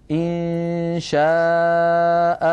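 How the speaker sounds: إن شاء slowly, final a drawn out; slow "insha'a" with a long end; pausal madd. Shown as a demonstration. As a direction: hold the last vowel of إن شاء. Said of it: "نقف عليها هكذا".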